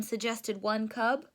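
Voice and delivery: usual speaking voice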